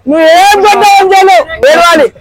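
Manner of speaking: high-pitched, heated retort; heated quarrelling. Acted. Quarrel over refusing to cry.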